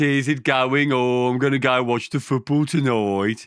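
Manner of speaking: dopey Birmingham football fan; Brummie, dragging out the last words; Brummie accent. Acted. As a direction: put on a broad Birmingham accent. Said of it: "Uh, Birmingham".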